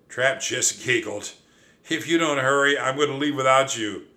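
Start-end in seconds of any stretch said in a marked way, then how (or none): 1.9-4.1 loud, high megaphone shout. Acted. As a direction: holler it across the camp. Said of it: "he shouted through the megaphone".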